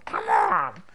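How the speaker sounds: very low silly voice; comic low exclamation; funny voice. Shown as a demonstration. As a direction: drop to a goofy low voice. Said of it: "use a funny voice".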